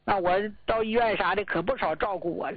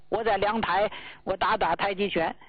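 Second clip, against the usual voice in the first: ordinary delivery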